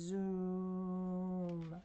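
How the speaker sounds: a long, steady, even zooming hum. Shown as a demonstration. Showing the class a lawnmower: steady zoom. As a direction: hold it like a lawnmower. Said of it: "It goes like this".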